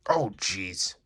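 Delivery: normal talking voice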